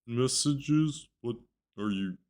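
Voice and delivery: a hint of a slow, dazed, worried coworker; correcting the impression. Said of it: "She sounded like".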